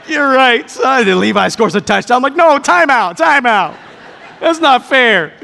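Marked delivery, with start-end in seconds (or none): 0.1-1.1 loud, high, laughing agreement. Real, not acted. Father laughs, conceding. 2.3-5.3 loud, high playful complaint; mock protest.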